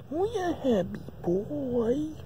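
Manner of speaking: high, slow cooing; baby talk. Acted. Owner cooing at his happy hamster, high and slow. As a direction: coo it sweetly to the pet.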